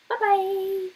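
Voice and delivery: high, singsong mocking farewell; gloating bye-bye. Real, not acted. About the fired instructor, a high gloating bye-bye. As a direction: wave him off with a taunting lilt.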